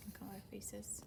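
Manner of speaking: soft unvoiced whisper; hushed aside. Real, not acted. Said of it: "aside to children".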